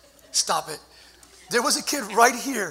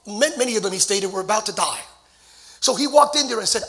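usual speaking voice

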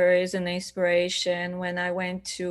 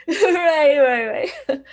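laughing through the words; amused laughing right; laughing agreement; 8 semitones higher, 8 dB louder, slower than usual. Real, not acted. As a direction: chuckle out a yes while still laughing. Laughs at Swedes joke.

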